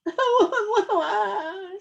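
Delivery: high, loud wah wah wail; mock wailing. A narrator's reading. Little Beaver wailing alone by the pond, high mock cry. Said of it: "said Little Beaver".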